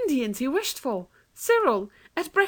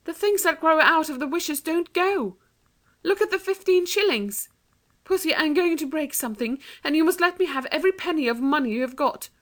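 put-on much higher, urgent girl's voice; 9 semitones higher than usual. A narrator's reading. Anthea urges Jane to help break the jug. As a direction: press your plan on her urgently. Anthea urging her sister.

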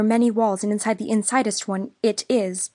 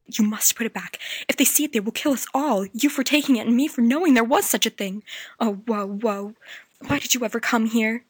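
much higher, fast, panicky voice; frightened girl; faster than usual. A narrator's reading. Ancient girl warning the children, fast and frightened. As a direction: plead in terror.

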